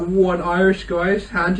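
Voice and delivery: usual speaking voice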